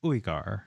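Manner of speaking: Uigar starting with a clean "ooh"; OO-ee-gar pronunciation. Shown as a demonstration. Streamer trying out the corrected name, Uigar said with "ooh". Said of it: "Pronounced like ooh".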